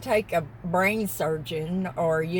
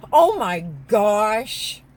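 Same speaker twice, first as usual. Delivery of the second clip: loud, drawn-out exclamation; real astonishment, stretched out slowly; amazed; 8 dB louder, slower than usual. Real, not acted. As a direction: blurt it loudly, stretching the words in wonder. Suddenly spots a pink truck.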